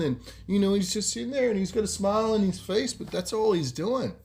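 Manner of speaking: ordinary delivery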